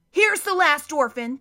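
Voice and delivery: loud, bossy henchman voice; gruff henchman, loud. A narrator's reading. Hook-handed man announcing the orphan, loud and bossy. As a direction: bark it like a brute of a henchman.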